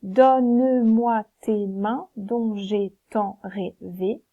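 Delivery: slow, loud, "donne-moi" as don-ne-moi; slow scansion. Shown as a demonstration. Teacher scans the decasyllable for pupils, slowly. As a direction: scan every syllable out slowly. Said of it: "On va bien dire".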